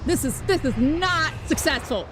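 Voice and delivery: loud, high-pitched frustration; dismayed outburst. Real, not acted. Player watching the attempt fail, loud dismay. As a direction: raise your voice as the plan collapses.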